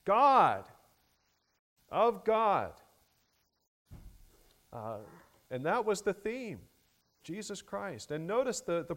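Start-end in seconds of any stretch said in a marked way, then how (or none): 0.0-2.7 each "God" loud and higher; emphasis on God. Shown as a demonstration. Preacher stressing the deity of Christ to the congregation, "God" emphatic. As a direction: drive home "God" with a pause before the repeat. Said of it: "an emphasis on the deity".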